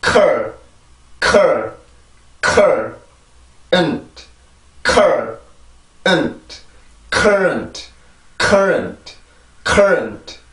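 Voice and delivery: stressed 컬, weak 은트, then joined as "current"; pronunciation drill. Shown as a demonstration. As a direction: puff the k of 컬, keep 은트 light. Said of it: "강세 음절이에요".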